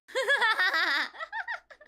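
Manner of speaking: loud high cackle; big squealing belly laugh. Real, not acted. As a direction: burst into helpless laughter. Cracks up at own remark.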